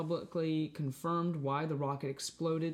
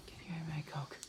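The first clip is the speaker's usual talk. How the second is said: soft whispered request; hushed, voiceless aside; whispering; 8 dB quieter, much faster than usual. Real, not acted. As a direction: whisper it, keeping under the talk. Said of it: "why are you whispering".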